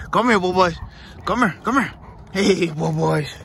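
high sing-song call to the dog; dog-talk call. Real, not acted. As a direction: coax the puppy over sweetly. Calling dog in high pet voice.

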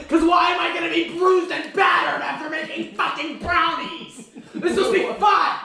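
high-pitched indignant yell. Real, not acted. Ranting about being bruised and battered after making brownies, yelling. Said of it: "What is he yelling about?".

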